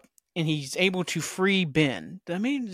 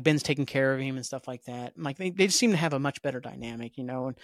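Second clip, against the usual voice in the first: ordinary talk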